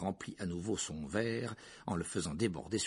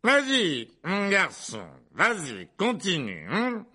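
higher, jeering aunt with a mocking laugh; loud goading taunt; 12 semitones higher, 11 dB louder, slower than usual. A narrator's reading. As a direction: bait the boy with scornful glee. Drunk aunt goading the boy. (provocation moqueuse, forte et aiguë)

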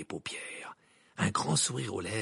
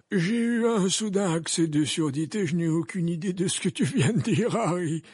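high, loud, airily pretending not to hear; Dumbledore, mock deaf; 7 semitones higher, 5 dB louder than usual. A narrator's reading. Dumbledore pretends to Harry he heard nothing, high and loud. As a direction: wryly play deaf to the insult.

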